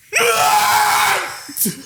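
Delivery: high, unvoiced wail; hammed-up howl; shrieking mock cry. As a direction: let out a mock sobbing shriek. Shrieking cry after quote.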